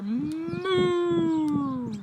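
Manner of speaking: long, drawn-out loud moo; cow mooing. Acted. Cow making music with friends, long moo. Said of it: "The cow with her".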